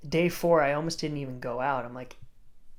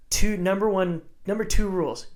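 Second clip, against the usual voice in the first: raised, loud, insistent voice; emphatic coaching; 5 semitones higher, 8 dB louder than usual. Retold or imitated. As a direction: lay down the rules to a surfer. Coach retells laying down rules.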